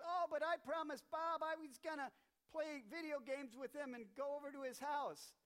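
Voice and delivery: put-on high pleading kid voice; whiny kid excuse. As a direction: kid wriggling out of chores. Johnny voiced making excuses.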